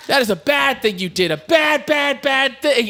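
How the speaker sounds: high mock scolding; hammed-up angry telling-off; imitated yelling. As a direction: scold it like a naughty pet, high-pitched. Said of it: "yelling at him".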